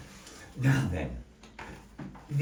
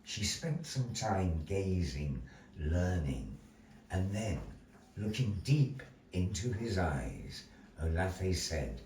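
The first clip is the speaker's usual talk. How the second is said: theatrical storyteller narration with long pauses; measured, dramatic tale-telling; story narration; 5 semitones lower, 3 dB quieter, slower than usual. A narrator's reading. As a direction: spin the tale for the audience.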